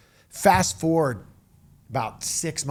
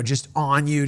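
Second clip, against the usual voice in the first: usual speaking voice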